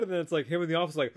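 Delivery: ordinary talk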